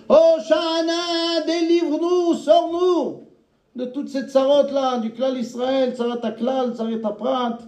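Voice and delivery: high, very slow, pleading cry; imploring prayer. Real, not acted. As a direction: beg God for deliverance. Preacher breaks into imploring prayer.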